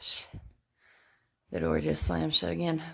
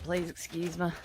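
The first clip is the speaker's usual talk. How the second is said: ordinary talk, nothing marked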